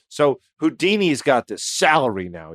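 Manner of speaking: normal talking voice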